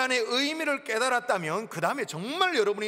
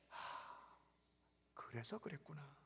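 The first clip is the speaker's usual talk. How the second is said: soft realization; 6 semitones lower, 18 dB quieter than usual. Acted. Believer voicing quiet understanding.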